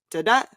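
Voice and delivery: DA-DA with the second beat stronger and rising; weak first, strong second on DA-DA; unstressed then stressed. Shown as a demonstration. Co-host demonstrates an iamb, second syllable of DA-DA stressed. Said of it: "An iamb is unstressed stress".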